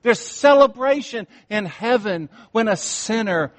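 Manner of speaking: preacher lifting the congregation toward joy; rising preaching. Acted. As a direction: build to a celebrating climax.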